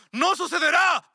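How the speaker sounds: very high, loud shouted refusal; full-throated outraged shout; furious shout. Acted. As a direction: scream your refusal at mother. Son refuses the wedding.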